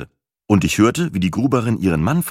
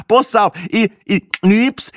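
high, loud hammed-up voice; Speckhut mocking; 13 semitones higher, 5 dB louder, slower than usual. Speckhut tops the joke for the table, high and hammy. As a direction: ham it up for the laughing table. Speckhut adds a mock line.

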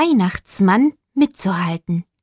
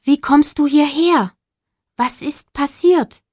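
put-on young Max asking; 6 semitones higher than usual. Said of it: "fragte Max".

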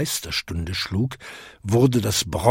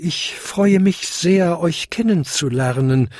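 tinkle a courteous greeting up high; tinkling little bell; 6 semitones higher than usual. A narrator's reading. Smallest bell greets the seven, high tinkling. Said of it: "klingelte die kleinste Glocke".

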